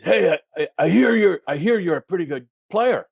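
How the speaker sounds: loud, high tough-guy voice; Jim King. Retold or imitated. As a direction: play the scary visitor sizing someone up. Jim King's voice acted at the door.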